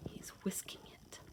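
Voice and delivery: soft whispered aside. Acted. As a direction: lean in and whisper it to the kids.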